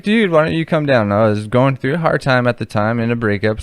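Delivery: ordinary delivery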